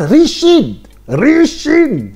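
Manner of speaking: high, loud excited shout of the name; high, loud fan cheer; hyped call. Real, not acted. Hyped about the general's name.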